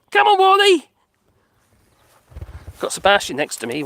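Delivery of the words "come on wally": calling out. Real, not acted. Calling the dog.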